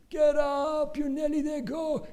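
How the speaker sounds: high, loud cheering yell; urgent high shout; crowd screaming encouragement. Retold or imitated. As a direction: urge the fallen runner on at full voice. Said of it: "Everyone screaming".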